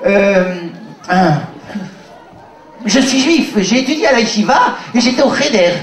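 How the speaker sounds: usual speaking voice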